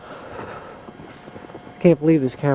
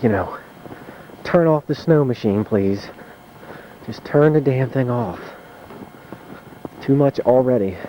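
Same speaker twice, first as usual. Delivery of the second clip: normal talking voice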